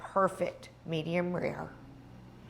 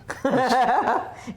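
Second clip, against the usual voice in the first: loud laugh; 6 semitones higher, 5 dB louder than usual. Real, not acted. Reacting to "salivating.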